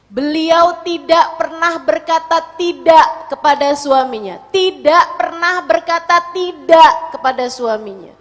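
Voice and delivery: loud, high, forceful declaration; emphatic preaching. Real, not acted. Preacher tells women Khadijah never refused her husband, emphatic.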